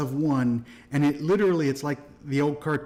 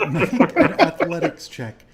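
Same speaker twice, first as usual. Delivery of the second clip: laughing; 4 semitones higher, 7 dB louder than usual. Real, not acted. GM laughing through instruction.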